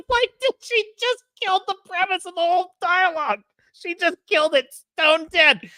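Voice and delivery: high-pitched, incredulous laughing rant; laughing disbelief. Real, not acted. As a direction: crack up in real disbelief, voice shooting up. Laughing disbelief at retold dialogue.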